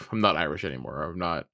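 mock offhand confession, low and soft; lightly voiced trickster giving up the pretence; dropping the act. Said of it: "he just drops the act".

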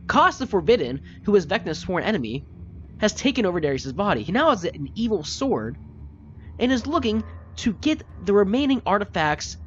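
high, loud, intense scolding; furious lecture. Acted. Cassius holding the gnome by the collar and yelling at him.

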